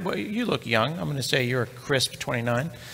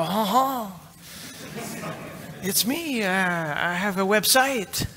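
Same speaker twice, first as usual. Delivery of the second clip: much higher, slow, dopey caller voice; goofy self-impression; 6 semitones higher, 5 dB quieter, slower than usual. Host phoning the hosting company about his site, high slow dopey voice. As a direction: play yourself as a clueless caller. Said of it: "I go".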